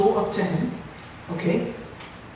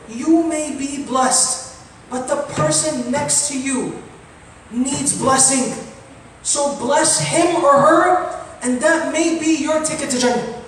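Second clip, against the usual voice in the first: slow emphatic plea; 3 semitones higher, slower than usual. Real, not acted. Preacher urges the congregation.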